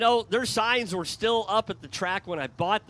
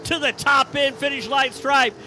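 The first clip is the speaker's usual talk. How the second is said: higher, louder play-by-play to the line; excited race call; 6 semitones higher, 5 dB louder than usual. Real, not acted. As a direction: build the call to the win. Live race call at finish.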